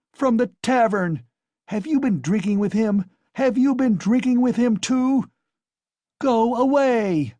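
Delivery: furious accusation. Acted. Wife drives the guest out.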